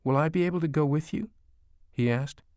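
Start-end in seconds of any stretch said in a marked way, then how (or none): 0.0-1.3 higher, quicker anxious question; a touch of a scared little boy; mildly frightened child. A narrator's reading. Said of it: "his voice frightened".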